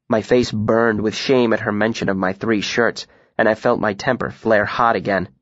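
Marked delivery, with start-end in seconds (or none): none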